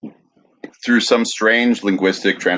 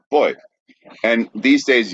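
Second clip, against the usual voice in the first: ordinary talk, nothing marked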